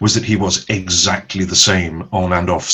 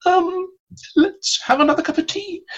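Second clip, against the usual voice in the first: much higher, small nervous voice; flustered stalling actress; 4 dB quieter than usual. Retold or imitated. Actress X stalling live on air, in a tiny high nervous voice. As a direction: squeak it out like a panicking guest. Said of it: "[name] said it again".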